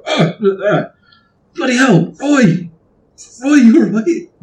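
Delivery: high-pitched shocked calling out; startled alarm. Acted. Guard finding his mate Roy hurt, startled alarm.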